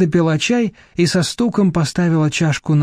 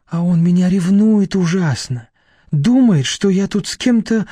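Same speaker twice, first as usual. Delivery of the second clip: plain reading voice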